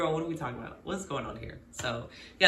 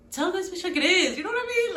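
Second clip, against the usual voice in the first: excited; 12 semitones higher, 4 dB louder than usual. Real, not acted. Ranting at character.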